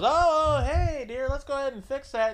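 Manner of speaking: high, loud intervening orderly voice; flustered orderly. Retold or imitated. Orderly interrupts an exposed nursing home patient, flustered and high-pitched. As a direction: jump in high and over-cheerful, imitating the orderly.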